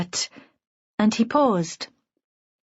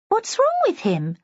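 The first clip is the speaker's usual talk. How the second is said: much higher, alarmed question; concerned onlooker; 12 semitones higher than usual. A narrator's reading. Woman behind the narrator asks about him, high concerned voice.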